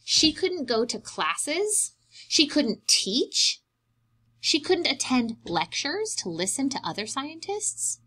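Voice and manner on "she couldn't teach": ordinary delivery, nothing marked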